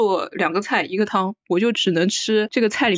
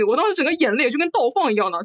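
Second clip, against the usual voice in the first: ordinary delivery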